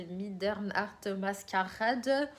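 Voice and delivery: slow, mock German pronunciation; German accent. Vlogger unsure how the title is said, German accent. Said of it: "je prends un accent allemand".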